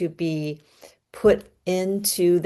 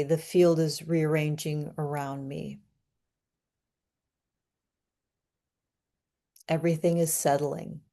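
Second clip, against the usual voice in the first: calm, soft, unhurried; calm affirmation; 4 dB quieter than usual. Shown as a demonstration. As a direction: speak the truth gently from the belly. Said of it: "say it in a calm voice".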